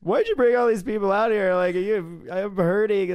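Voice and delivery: high, loud whimpering mock plea; whiny crying mock. Knocked-out guy begging her not to bring the crowd, mock whining. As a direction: whine it like a beaten man.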